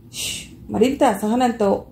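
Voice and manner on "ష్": sharp unvoiced shush to silence her; angry hush. Brother silencing his sister with a sharp angry shush. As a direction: hiss her quiet, annoyed. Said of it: "క్రోధస్వరంతో వారించాడు".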